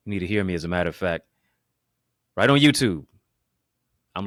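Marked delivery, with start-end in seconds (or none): 2.4-3.1 loud, high, hyped call-out; excited shout. Real, not acted. Preacher to YouTube viewers, a loud excited shout. Hyping up the online audience.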